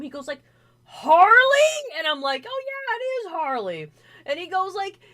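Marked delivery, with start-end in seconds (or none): 0.9-2.0 very high, loud disbelieving cry of the name; shocked Harley. Retold or imitated. Friend gasping the name in shock, loud and high. 2.5-3.9 high, bright mock-excited reply; excited confirmation. Telling Bronny it really is Harley, high and excited.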